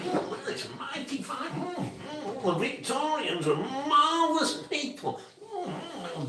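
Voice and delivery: squeaky put-on admiring old chap; hammed-up high old enthusiast voice; imitating Fred Dibnah. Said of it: "And he looks at it".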